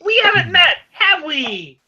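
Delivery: very high, loud greeting; high-pitched, pointed introduction; pointed false friendliness. Acted. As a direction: pitch it way up as a challenge to the newcomer. Menacing introduction to a stranger.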